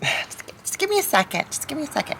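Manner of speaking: high-pitched, flustered cartoon mom; sigh, then plead in a high strained voice. Lois struggling with the pumpkin, high and flustered.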